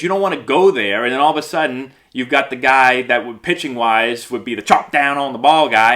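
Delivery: usual speaking voice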